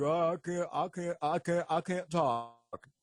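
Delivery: mock stammering supplicant, slowing at the end; afflicted churchgoer. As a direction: struggle the words out like a hammed-up patient. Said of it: "And it's like".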